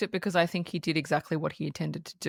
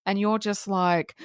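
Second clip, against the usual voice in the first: ordinary talk, nothing marked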